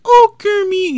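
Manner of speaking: high loud froggy cry; Kermit impression. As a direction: call out like Kermit. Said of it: "a little Muppet impression thing".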